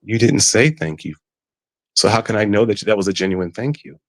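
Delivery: ordinary delivery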